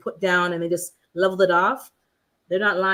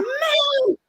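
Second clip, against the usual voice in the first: high, shouted "Man" as if worn out from digging; mock exhausted groan. Friend mimicking the host worn out after digging. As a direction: imitate a worn-out gardener's cry. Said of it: "You're like".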